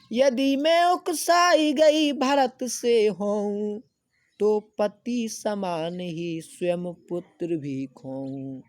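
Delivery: slow, high, loud declaiming; slow lofty verse reading; poem recitation. Acted. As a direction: chant the verse slowly to the class.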